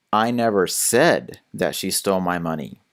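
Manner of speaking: SAID stressed, tune falling after. Shown as a demonstration. Tutor showing how emphasis shifts meaning, stress on SAID. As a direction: punch the word said, let the rest fall. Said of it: "Let me give you a few examples".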